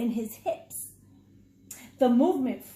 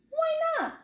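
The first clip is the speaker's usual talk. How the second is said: much higher, bright little mouse squeak; Papi Mouse. Acted. Papi Mouse answering Mommy, high and squeaky. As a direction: toss it off cheerfully. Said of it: "said Papi said".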